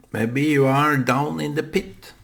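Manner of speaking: ordinary talk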